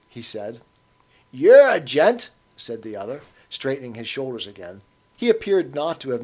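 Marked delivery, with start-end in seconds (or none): 1.3-2.3 slow, louder, higher Cockney thanks; an old man flattered by the offer; pleased old Cockney. A narrator's reading.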